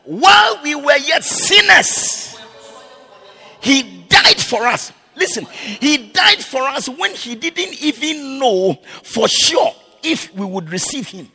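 loud, high, rising preacher's cry; fiery sermon climax; preaching at full force. Real, not acted. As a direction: preach it out, building to a shout.

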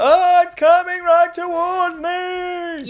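very high slow yelled panic; drawn-out shrieking dread; Austin Powers steamroller. Retold or imitated. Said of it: "The Austin Powers, like, steamroller".

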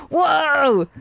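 high, surprised mock gasp; dumbfounded whoa. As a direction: act stunned and amazed. Imitating dumbfounded reaction.

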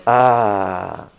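low relieved ahh; sigh of relief. Retold or imitated. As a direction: melt into the warm towel. Reaction to warm towel.

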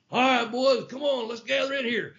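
put-on rally the boys like a football coach; mock coach huddle call; coach calling the team. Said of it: "He's like a damn coach".

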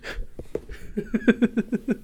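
airless wheezing laugh; breathy silent laugh. Real, not acted. Laughs at the punchline.

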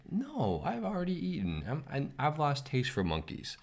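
high, coaxing crocodile voice; sly crocodile. Acted. Crocodile tricking the monkey in a fable, high coaxing voice. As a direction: sweet-talk the monkey onto your back. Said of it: "And the crocodile says".

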